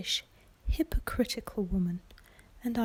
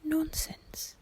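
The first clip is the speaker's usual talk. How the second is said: higher whispered scoff from the flustered wife; dismissive mother; 8 semitones higher, 6 dB louder, much slower than usual. Acted. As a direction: brush it off as the irritated mother. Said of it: "Missus Bennet only said".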